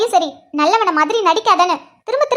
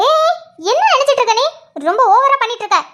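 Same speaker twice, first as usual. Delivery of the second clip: higher, indignant scolding; angry yelling; 4 semitones higher than usual. Acted. Fed-up wife tells husband off for overdoing it, angry yelling. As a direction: snap angrily with a high-pitched opening. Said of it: "எதுக்கு இப்படி கத்துற".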